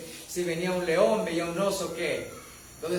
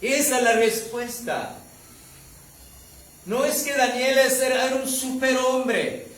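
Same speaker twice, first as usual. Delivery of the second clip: higher, louder impassioned sermon voice; fervent preaching; 5 semitones higher, 6 dB louder than usual. Acted. Pastor urges his beloved brothers to trust God, preaching fervently. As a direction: preach it out with full conviction.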